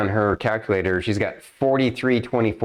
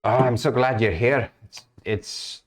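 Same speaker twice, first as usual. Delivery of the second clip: worried guild master, lightly voiced, a bit higher; guild master NPC; 7 semitones higher than usual. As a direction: GM playing a fretful guild master breaking bad news. GM voices Mister Zolk.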